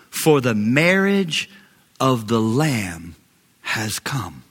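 ordinary talk

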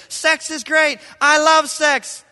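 high, loud, slow "I love sex"; hammed-up joyful declaration; exuberant proclamation. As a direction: proclaim it with glee. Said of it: "when I say".

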